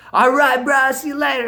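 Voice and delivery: high, loud send-off; hollered breezy farewell; imitated shouted goodbye. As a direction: call it out over a running engine. Friend calling out, driving off.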